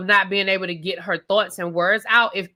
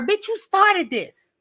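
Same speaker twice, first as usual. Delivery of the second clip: much higher, very slow, outraged disbelief; exasperated call-out; 8 semitones higher, much slower than usual. Real, not acted. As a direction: stretch it out, stunned that she started it. Calling out K K.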